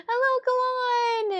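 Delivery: high, loud, slow sing-song hello; bright drawn-out welcome to the viewer; enthusiastic greeting. Real, not acted.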